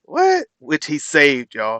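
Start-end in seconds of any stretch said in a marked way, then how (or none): 0.0-0.5 surprised. Real, not acted. Streamer reacts to the speech.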